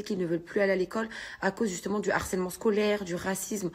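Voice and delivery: parle bas et doucement, encore bouleversée; émue, voix retenue. Real, not acted. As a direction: des émotions qui redescendent à peine. Émotion, voix basse. (voix basse d'une maman encore secouée)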